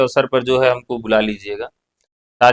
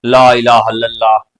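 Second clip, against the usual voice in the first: lightly recited ला इलाहा इल्लल्लाह, the ला stressed; a hint of a devotional recital; imitating a recitation; 3 semitones lower, 4 dB louder than usual. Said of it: "सबसे अधिक बार ला का उच्चारण होता है".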